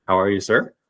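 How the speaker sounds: normal talking voice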